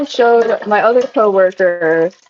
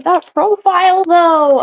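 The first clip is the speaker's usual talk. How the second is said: mock old lady voice; 10 semitones higher, 3 dB louder, slower than usual. Retold grandma line.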